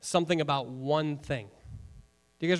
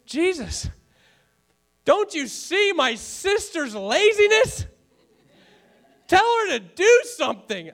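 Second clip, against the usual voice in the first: much higher, louder, slowed whiny complaint; hammed-up sister begging Jesus to scold her; a laughing, overdone plea of an exasperated sister; exasperated Martha; 11 semitones higher, 5 dB louder, slower than usual. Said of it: "Martha finally gets so exasperated".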